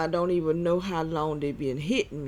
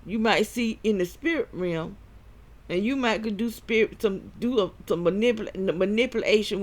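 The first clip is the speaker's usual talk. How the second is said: preaching; 4 semitones higher, 3 dB louder than usual. Acted. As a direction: warn them like a preacher. Preaching against soulish realm.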